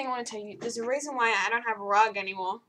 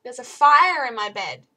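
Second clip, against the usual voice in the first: misheard line; 10 dB louder than usual. Retold or imitated. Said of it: "my dad thinks I said".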